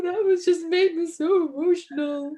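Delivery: high, half-laughing mock sob; mock teary wail. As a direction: whimper it like a tearful overreaction. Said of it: "burst into tears".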